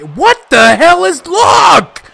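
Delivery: frustrated yell climbing high; outraged shout. Real, not acted. Player protesting at the game, outraged. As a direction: burst out, ending on a high holler.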